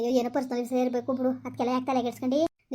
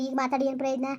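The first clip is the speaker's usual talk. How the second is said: normal talking voice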